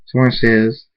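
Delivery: plain reading voice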